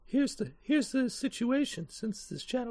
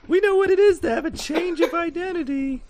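higher, louder retake of the line; higher, louder NPC voice; 8 semitones higher, 8 dB louder than usual. Acted. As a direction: push the voice up and louder. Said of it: "This is a terrible voice".

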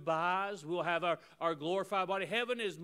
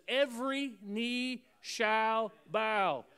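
slow, weighty, word by word; preacher's drawn-out proclamation; emphatic scripture declamation; 5 semitones higher, 3 dB louder, much slower than usual. Real, not acted. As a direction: proclaim it slowly, spacing every word.

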